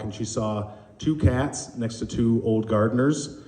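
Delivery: ordinary talk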